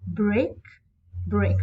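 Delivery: weight each "break" alike; spondee, a pause between "break" and "break"; equal stress on both. Shown as a demonstration. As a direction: BREAK and BREAK as two firm beats. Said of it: "Both syllables are stressed".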